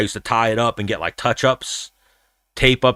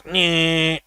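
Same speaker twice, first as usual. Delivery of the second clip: mock electric clipper buzz, pitched high; imitating a barber faking the clippers; buzzer noise; 7 semitones higher than usual. Mimicking the barber's fake buzzer noise to friends, high mock buzz. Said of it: "he goes".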